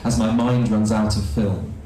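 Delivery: dramatic spoken-word delivery with hushed asides; performance poetry recital. Acted. Poet on stage performing to the audience, dramatic recital. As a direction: perform each line like a staged confession.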